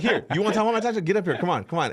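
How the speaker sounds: high, very fast taunting invitation; mock challenge. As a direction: rush the heckler up to the stage. Retells calling heckler on stage.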